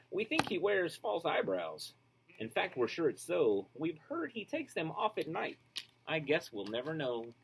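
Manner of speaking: put-on lively, sing-song reading to children; storybook read-aloud. A narrator's reading. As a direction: read it like a bedtime picture book. Reads picture book aloud.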